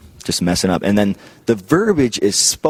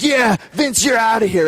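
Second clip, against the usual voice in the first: much higher, cartoonish gleeful voice; mock Steiner kicking Vince; 12 semitones higher than usual. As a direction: gloat as you throw him out. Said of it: "She's like".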